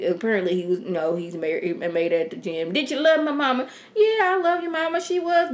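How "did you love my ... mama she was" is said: higher voice for the gun-wielding son and Jim; retold TV exchange. Recapping the son confronting Jim, voiced higher. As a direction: voice the two characters a notch up.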